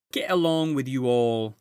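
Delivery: a brisk, busy housewife giving orders; Mrs Beaver. Acted.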